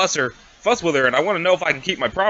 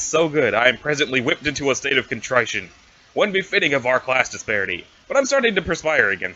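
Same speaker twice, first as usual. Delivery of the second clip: ordinary talk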